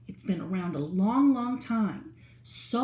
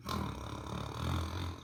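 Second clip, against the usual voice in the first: a mock snoring gasp; hammed-up snore for the kids; pretend snore; 12 dB quieter than usual. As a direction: play-act dozing off mid-story. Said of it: "I automatically fall asleep".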